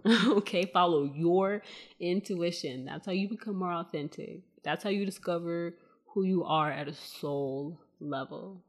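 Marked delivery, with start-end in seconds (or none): 0.7-2.8 slow down and land firmly on "your"; "your" set apart and stretched; deliberate repeat, weight on "your"; stress on YOUR. Shown as a demonstration. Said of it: "Emphasis on the your".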